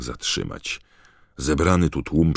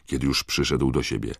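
usual speaking voice

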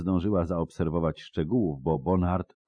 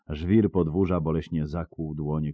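usual speaking voice

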